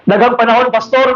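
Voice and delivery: usual speaking voice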